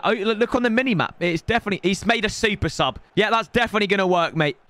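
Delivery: ordinary talk, nothing marked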